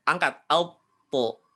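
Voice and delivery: alpo drawn out thick and heavy; slow thickened alpo. Shown as a demonstration. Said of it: "tebalkan, alti".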